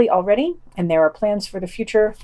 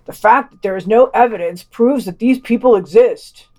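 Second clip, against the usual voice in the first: louder, lightly voiced official stating it as obvious proof; mock Red Scare official; 2 semitones higher, 6 dB louder than usual. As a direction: play a smug cold-war official, a touch overdone. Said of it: "Adam Curtis interviewing those Red Scare officials".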